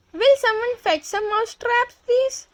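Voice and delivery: very high, rising teacher plea; shouted plea. A narrator's reading. Teachers beg for traps, in a high cry. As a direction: plead high-pitched to the end. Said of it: "They stand upon the desk and shout".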